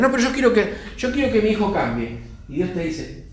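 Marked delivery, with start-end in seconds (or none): 0.0-2.2 insistent parent objection. Acted.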